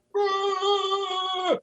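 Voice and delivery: put-on high, hooted boo like a jeering kid; childish boo at the rival; mock booing crowd. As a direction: jeer from the desk with a high boo. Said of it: "people standing on the desk going".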